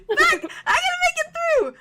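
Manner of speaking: very high, loud tearful cry; real crying outburst. Real, not acted. Player struggling through an emotional scene, loud tearful outburst. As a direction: shout it through tears and laughter. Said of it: "you're gonna make me cry".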